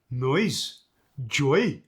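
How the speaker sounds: "noise, joy." rising on second vowel; internal vowel lilt. Shown as a demonstration. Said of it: "see how it went upwards".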